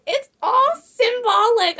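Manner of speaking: high, slow mock mom voice; imitating mom. Quoting mom defending the first Saw movie, in a high mocking imitation. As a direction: mimic mom, drawn out and high-pitched. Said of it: "My mom was like".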